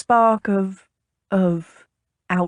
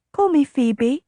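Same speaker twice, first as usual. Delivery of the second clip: higher-voiced, impulsive invitation; 6 semitones higher than usual. A narrator's reading. Lady inviting the captain to use her name, impulsive. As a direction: blurt it in a higher voice. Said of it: "she said impulsively".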